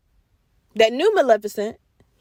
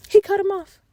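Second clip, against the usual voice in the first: ordinary delivery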